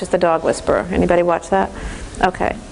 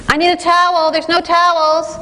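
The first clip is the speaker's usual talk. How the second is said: whining son; 9 semitones higher than usual. Retold or imitated. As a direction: complain like a stranded kid. Son complaining, no towels.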